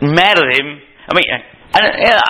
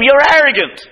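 higher contemptuous snap; Eliab's contempt; 10 semitones higher than usual. Retold or imitated. Preacher voicing Eliab calling David arrogant, higher contempt. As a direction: spit it at him. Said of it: "He says to him".

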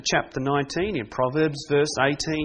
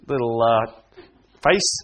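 normal talking voice

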